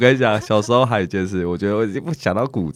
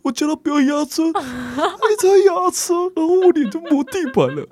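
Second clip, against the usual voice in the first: imitating little sister. Little sister telling mom her brother made her fall, high whiny kid voice.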